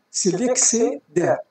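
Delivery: ordinary talk, nothing marked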